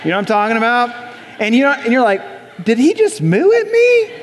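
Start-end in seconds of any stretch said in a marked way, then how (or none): 2.6-4.1 high-pitched incredulous question; mock astonishment. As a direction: squeak it out, baffled and offended. Acts startled reaction to mooing.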